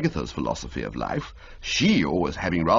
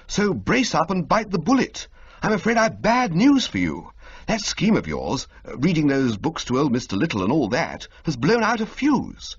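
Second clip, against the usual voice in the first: higher, lively, breaking bad news; agitated young toff; 9 semitones higher than usual. Bertie to Jeeves about the scheme, high agitated toff voice. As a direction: brace him for a blow.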